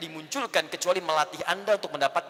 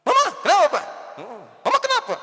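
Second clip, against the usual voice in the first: high, loud, needling questions; complaining husband; 10 semitones higher, 4 dB louder than usual. Acted. Husband needling his wife at home: a loud, high nagging voice. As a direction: pester the wife, pitched up.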